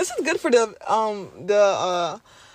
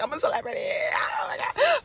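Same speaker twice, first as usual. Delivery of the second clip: excited shout; 8 semitones higher than usual. Real, not acted. Excited self-hype shout.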